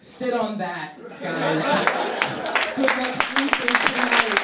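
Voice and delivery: closing the set, loud to the crowd; loud sign-off. Real, not acted. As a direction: project the goodbye to the room.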